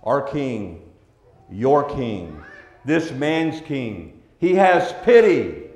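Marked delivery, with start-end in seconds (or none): none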